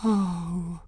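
soft, high, airy sigh; breathed. A narrator's reading. Girl sagging in his hand, breathed softly. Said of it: "she breathed".

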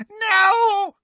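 very high anguished scream. A narrator's reading. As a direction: scream in disbelief. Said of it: "she screamed".

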